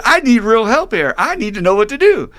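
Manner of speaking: high, loud desperate begging; dangling man argues with the voice from above; frantic pleading. Acted. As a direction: plead with God, losing your grip. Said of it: "more and more frantic".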